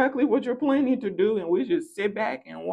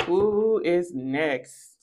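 slowest, lower and ominous; slower, lower who is next; much slower than usual. Shown as a demonstration. Slowly threatening the next person in line. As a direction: drag it out, lower and darker.

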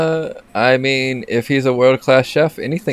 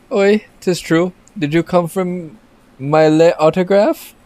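bad French accent; 6 semitones higher than usual. Chef greeting the player in bad French accent. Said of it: "my French accent is terrible".

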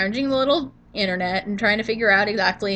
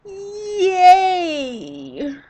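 mock cheer; 8 semitones higher than usual. Sarcastic cheer at allergies.

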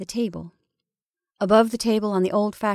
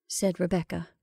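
ordinary delivery, nothing marked

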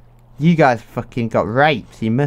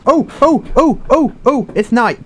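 panicked oh; 8 semitones higher than usual. Real, not acted. Panics as night falls in game.

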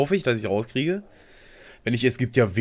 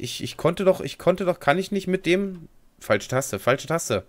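normal talking voice

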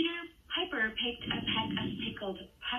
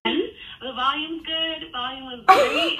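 loud, high, laughing reassurance; laughing protest; 5 semitones higher, 10 dB louder, slower than usual. Real, not acted. Prankster telling caller the volume is fine, loud and laughing. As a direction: burst out louder and higher, laughing through it.

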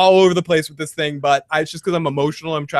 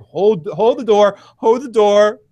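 mock desperate cry; 3 semitones higher, 3 dB louder than usual. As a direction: plead as if barring a door.

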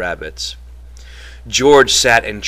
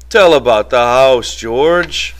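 begging; 3 semitones higher, 6 dB louder, slower than usual. A narrator's reading. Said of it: "Lenny begged".

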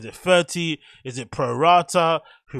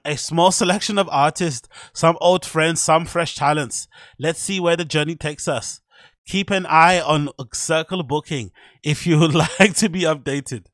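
reading the caption in a put-on German accent, a bit higher and louder; hammed-up German accent, stiff and formal; 4 semitones higher, 6 dB louder than usual. Vlogger reading a DJ's caption in a German accent. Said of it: "but my German accent".

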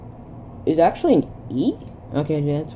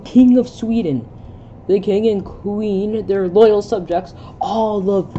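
higher, louder theatrical read; lightly heightened character voice; 6 semitones higher, 6 dB louder, slower than usual. Acted. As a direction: play it up like a stage line. Reads dialogue in heightened voice.

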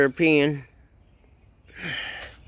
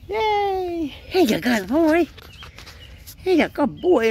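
much higher, slowed doggy praise; 12 semitones higher, slower than usual. Real, not acted. Owner praising his good boy puppy, high and slow.

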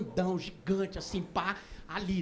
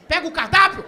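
louder, urgent order; exasperated order; 2 semitones higher, 6 dB louder than usual. Acted. Urging the waiter to fetch the menu, exasperated. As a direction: burst out with the request, out of patience. Same request, patience gone.

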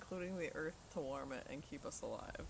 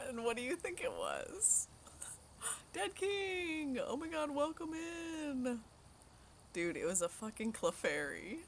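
normal talking voice

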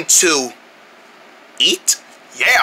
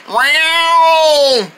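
long higher-pitched yowl; cat meow; 5 semitones higher than usual. Acted. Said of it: "sounds like there's another cat".

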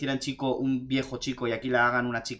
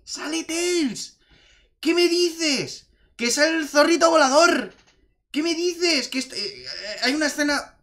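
much higher, slow squealing amazement; thrilled disbelief; 12 semitones higher, slower than usual. Retold or imitated. Fan recalling his cinema reaction, high-pitched amazement. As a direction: relive the shock of seeing him.

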